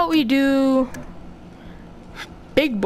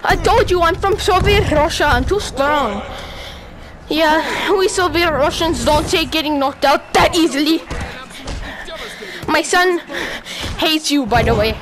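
raised, hammed-up Soviet Russian fighter voice; put-on Soviet Russian voice. Gamer taunting his boxing opponent as a Soviet Russian, mock Russian voice. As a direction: play a trash-talking fighter in a mock Russian accent.

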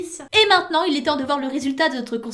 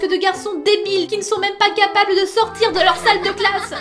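mock despair; 5 semitones higher, 5 dB louder than usual. Dramatic lament over crush.

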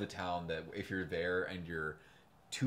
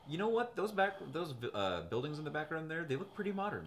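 high-pitched musing, as if just noticing; retold musing; 9 semitones higher than usual. Telling friends about spotting modern buildings, high-pitched musing. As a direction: voice lifted well up for a passing thought. Retelling own sudden thought while watching.

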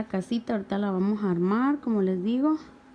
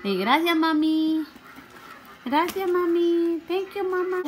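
prompting thanks; 7 semitones higher, slower than usual. Pretend. Mother prompting child to say thanks.

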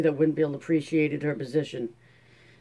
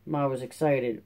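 usual speaking voice

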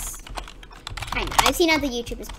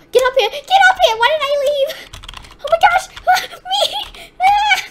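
loud, very high frantic shouts; real game-scare shrieking; panicked screaming; 13 semitones higher, 7 dB louder than usual. Real, not acted. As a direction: scream for them to get away from danger. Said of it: "I'm sorry if I'm screaming a lot".

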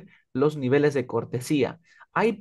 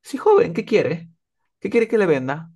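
a shopkeeper's loud, higher call, a bit brusque; blunt shopkeeper; 6 semitones higher, 5 dB louder than usual. Retold or imitated. Shopkeeper asks a young customer bluntly, loud and higher. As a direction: bark it out like a blunt shop clerk. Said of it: "el señor de la tienda que vende te dice".

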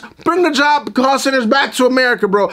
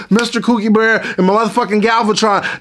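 usual speaking voice

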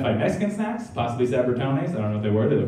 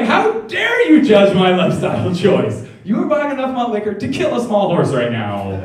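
louder, higher indignant protest; hammed-up offended huff; snapping back at the beer-buying judge, loud and high; mock outrage; 9 semitones higher, 6 dB louder than usual. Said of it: "I was like".

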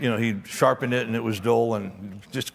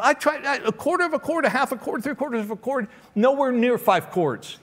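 mock exasperated complaint; 9 semitones higher than usual. Hillbilly complaining, high pitch.

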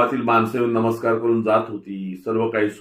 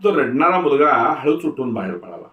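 plain reading voice

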